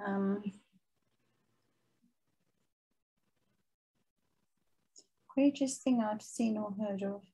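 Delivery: soft murmur, thinking the question over aloud; half under her breath, turning the question over; musing to herself. Real, not acted. Guest quietly repeating the host's question to herself while thinking.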